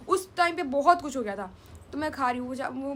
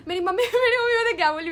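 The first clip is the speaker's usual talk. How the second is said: laughing; 5 semitones higher, 5 dB louder than usual. Real, not acted. Cracking up retelling.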